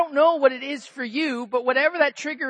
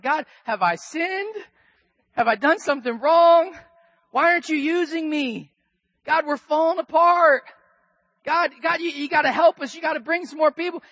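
crying out to God; 4 semitones higher, 3 dB louder than usual. Retold or imitated. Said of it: "I started crying out to God".

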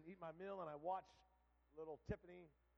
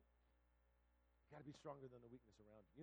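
"be stronger" low, soft and slow; quiet, weighty "stronger than the weakness"; hushed repeat; 5 semitones lower, 10 dB quieter than usual. Real, not acted. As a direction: drop down to press home "be stronger". Lesson repeated softly for weight.

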